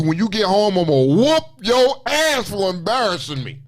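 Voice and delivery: a slow, mock-angry parent promising a whooping; a touch of a scolding parent, drawn out; angry parent threat. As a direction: play the furious mom laying down the threat. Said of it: "your parents found out ... and told you".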